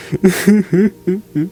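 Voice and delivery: high, cartoonish evil laugh; villain cackle. Gamer cackling at a villager, hammed-up. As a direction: cackle at your own joke.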